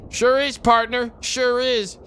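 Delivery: put-on high, loud old-timer cowboy; slow rowdy cowpoke twang. Cowboy character Gabby voiced.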